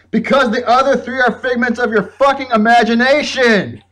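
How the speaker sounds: high, loud exasperated yell; mock-furious high yell; retold shouting punchline. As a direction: belt the punchline out at him. Punchline of retold Banky rant.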